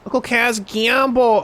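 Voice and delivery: put-on high, pleading kid voice begging Uncle Kaz; whiny child. Streamer mimicking Haruka begging her uncle, high whiny kid voice. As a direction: mimic a whining little girl nagging to gamble. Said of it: "who'd be like".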